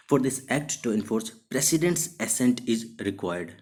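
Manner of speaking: very slow, clear reading; teacher dictating example; slow dictation. Shown as a demonstration. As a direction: read it word by word. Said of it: "example sentence".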